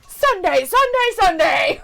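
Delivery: high, loud monster truck announcer. Retold or imitated. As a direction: hype it like a monster truck commercial. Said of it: "It's the commercials that we used to see".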